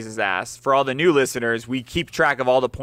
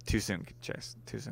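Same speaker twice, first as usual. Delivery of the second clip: whispered mock hurt; 5 semitones lower, 18 dB quieter than usual. Real, not acted. Mock hurt about vacation joke.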